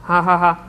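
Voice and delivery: a short harsh disbelieving laugh; recluse's frightful mocking laugh; dreadful old cackle. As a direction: cackle like a half-mad woman. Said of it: "зайшлася реготом, своїм жахливим колишнім реготом".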